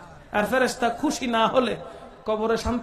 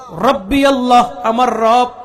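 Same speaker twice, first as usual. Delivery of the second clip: louder, steady proclaiming of রব্বি আল্লাহ; firm declaration; 8 dB louder, slower than usual. Acted. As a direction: declare it with conviction. Firm answer to angels' question. (দৃঢ় কণ্ঠে ঘোষণা)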